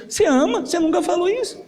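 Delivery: put-on high small boy voice, wounded; child's hurt question. The boy answers his father, high and hurt. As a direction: ask it as a hurt little boy.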